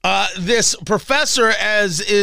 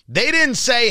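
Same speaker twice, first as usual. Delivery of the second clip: ordinary talk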